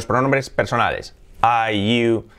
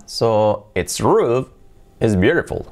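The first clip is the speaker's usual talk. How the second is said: slow demo; 3 semitones lower, slower than usual. Shown as a demonstration. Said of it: "Hablando de una casa".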